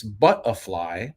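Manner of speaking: Cockney "butterfly" with the T dropped to a glottal stop; Cockney accent. Shown as a demonstration. Host giving British accent tips, Cockney accent. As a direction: say "butterfly" like a Londoner, Cockney, drawn out. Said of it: "more of a Cockney British accent".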